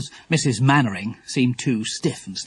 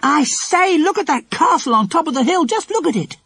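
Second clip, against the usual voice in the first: put-on high, loud girlish call; excited shout; 4 dB louder than usual. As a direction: call out, thrilled by the castle. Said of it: "Lucy Ann gave a shout".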